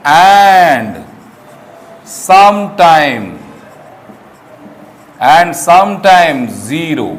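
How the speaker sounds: very slow, drawn out; slow dictation. Shown as a demonstration. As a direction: dictate the rule slowly. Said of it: "अब लिखोगे". (धीरे-धीरे लिखवाना)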